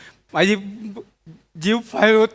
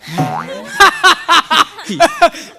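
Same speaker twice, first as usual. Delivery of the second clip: high staccato burst of laughter; forced laugh; 6 semitones higher than usual. Acted. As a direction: laugh it out as a scripted line. Laughs as his only line.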